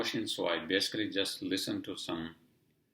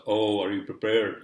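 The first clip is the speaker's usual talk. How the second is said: put-on louder, rough try at a Japanese accent; attempted Japanese accent; 3 semitones higher, 10 dB louder than usual. Laundry lady warns customer of snowstorm, imitated Japanese accent. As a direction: imitate her Japanese accent loudly, not very well. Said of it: "in her accent. I can't do Japanese accents".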